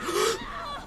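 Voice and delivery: hammed-up sharp high gasp; mock gasp. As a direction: fake a startled gasp. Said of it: "back in character".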